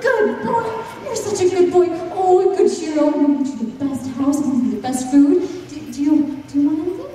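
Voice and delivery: high, gushing doting wife. Acted. Wife praising Shiro for bringing gold, high gushing coo. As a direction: coo over the dog like a proud owner.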